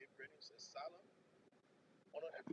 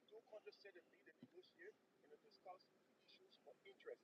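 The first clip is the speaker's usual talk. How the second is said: usual speaking voice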